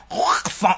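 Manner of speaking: "croissant" with a long throat-scraping r, like spitting; throaty spitting croissant. Shown as a demonstration. Showing how much vocal it takes, spitty "croissant". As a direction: hawk up the middle of "croissant". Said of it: "like you're trying to spit".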